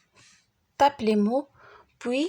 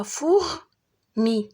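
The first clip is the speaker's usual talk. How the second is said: "فوغ", pause, "مي"; split into syllables; 4 semitones higher than usual. Shown as a demonstration. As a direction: read "فوغ مي" as two separate syllables. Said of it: "كنقراوها".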